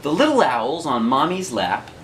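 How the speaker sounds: ordinary delivery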